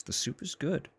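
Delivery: squeaky high-pitched voice; high silly voice. Pretend. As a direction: say it in a cute high voice. Silly voice praising the soup.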